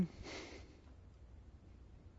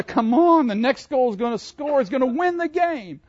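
eager fan; 10 semitones higher, 5 dB louder than usual. Retold or imitated. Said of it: "you're going".

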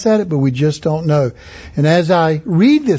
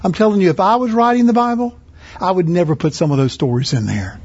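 ordinary delivery

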